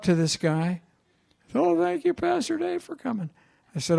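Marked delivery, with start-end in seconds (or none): 1.5-3.3 put-on much higher, breathy voice of the young man, thanking him; playing the relieved father in a high voice; grateful young man.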